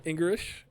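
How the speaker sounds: "English" said loudly as Engrish; mock mispronunciation. Real, not acted. Reader mocks bad translation, "English" said as Engrish. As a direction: say "English" with an r replacing the l, high and loud.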